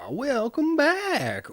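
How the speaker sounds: high, slow, sing-song welcome; theatrical welcome. Acted. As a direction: stretch it out like a showman.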